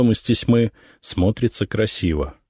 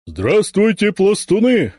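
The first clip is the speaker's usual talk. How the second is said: high, loud parade greeting; shouting drill sergeant; 12 semitones higher, 3 dB louder, slower than usual. A narrator's reading. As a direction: call out to the whole company. Ataman greets the formation.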